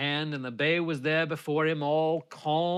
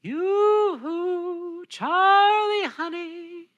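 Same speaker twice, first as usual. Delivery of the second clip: much higher, slow sing-song call; tinkly mermaid voice; 4 dB louder, much slower than usual. A narrator's reading. Mermaid calling to Charlie, high and tinkly. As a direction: coo his name like a sweet little mermaid. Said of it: "that same sweet voice".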